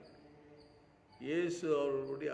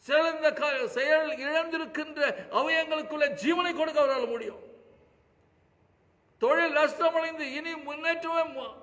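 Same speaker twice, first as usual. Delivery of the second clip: impassioned preaching; 13 semitones higher, 12 dB louder than usual. Acted. Preacher to congregation about the widow, full-force preaching. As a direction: lift your voice as a preacher crying out for the widow.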